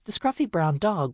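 plain reading voice

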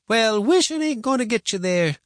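high-pitched, brusque grandma; interrupting. A narrator's reading. Grandma interrupting boy's wishing, brusque. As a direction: cut in on the daydream. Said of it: "she interrupted him".

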